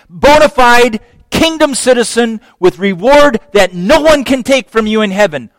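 exultant high preaching; full-force preaching. Acted. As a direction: preach it out with rising joy.

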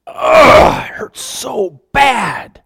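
a high pained groan, then slow hurting words; groan, then drag out the hurt; acted pain. Acting the psalmist's pain.

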